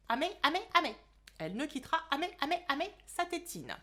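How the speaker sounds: «amé» pour «jamais», comme avec une tétine dans la bouche; zozotement. Acted. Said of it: "vous allez devoir zozoter pour parler". (Nina parle avec sa tétine, en zozotant)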